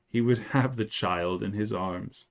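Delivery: plain reading voice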